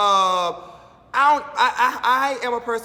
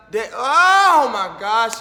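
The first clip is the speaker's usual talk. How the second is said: exasperated groan; 3 semitones higher, 9 dB louder, much slower than usual. Real, not acted. Exasperated outburst about Eva.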